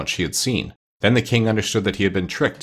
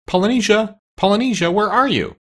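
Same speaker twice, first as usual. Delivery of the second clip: high-pitched searching call; raised, high voice hailing someone out of sight; 12 semitones higher, 3 dB louder than usual. A narrator's reading. Doctor calls for missing parrot.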